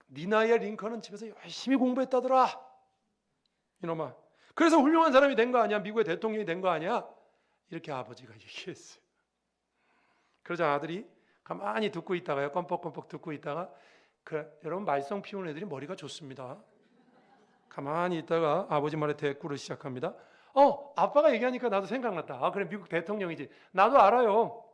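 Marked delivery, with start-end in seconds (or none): 0.1-7.1 a father lecturing his son, swelling louder midway. Retold or imitated. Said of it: "훈계하듯 이렇게 말했답니다". 20.5-24.6 cheeky son. Retold or imitated. Son cheekily answers his father.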